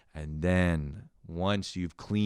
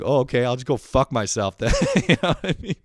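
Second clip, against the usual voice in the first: louder, breaking into a laugh; 4 semitones higher, 6 dB louder than usual. Retold or imitated. Brother snubbed by his sister, laughing it off. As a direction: say it through amusement.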